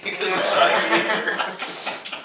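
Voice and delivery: laughing it out in a slow breathless giggle. Real, not acted. Author jokes about her shirt signing, laughing. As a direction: dissolve into laughter mid line.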